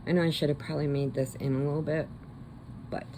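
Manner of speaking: normal talking voice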